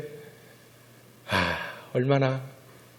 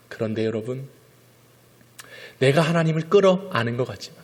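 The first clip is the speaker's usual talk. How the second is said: ordinary talk, nothing marked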